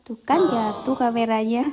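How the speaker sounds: loud playful yelp of alarm; mock alarm. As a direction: play up a fake camera drop. Camera falls, playful alarm.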